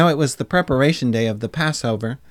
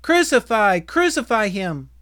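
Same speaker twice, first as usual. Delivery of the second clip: high, insistent shout; crowd shouting; 7 semitones higher, 5 dB louder than usual. A narrator's reading. Crowd refuses Pilate's wish to release Jesus, shouting. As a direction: shout it over the governor's plea. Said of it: "But they shouted".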